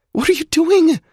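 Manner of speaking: high panicked cry. A narrator's reading. Alex panicking as Zai drags Duncan, high panicked cry. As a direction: blurt it out in panic. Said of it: "she panics".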